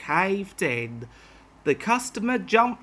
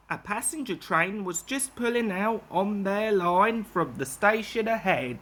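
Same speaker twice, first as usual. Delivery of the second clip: usual speaking voice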